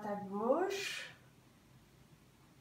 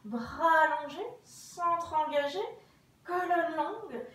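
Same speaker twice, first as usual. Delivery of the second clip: usual speaking voice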